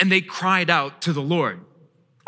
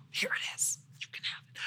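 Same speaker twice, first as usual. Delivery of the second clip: hushed whisper, pointing at the bottle; 17 dB quieter, faster than usual. Retold or imitated. What the tired dad wants to tell his kids, whispered. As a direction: breathe it out with no voice at all. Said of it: "and just go".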